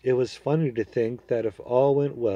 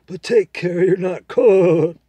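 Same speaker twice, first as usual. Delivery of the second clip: proud talking horse, higher and louder, persuading; a confident horse making its case to the boy; 8 semitones higher, 10 dB louder than usual. Acted. Horse warns.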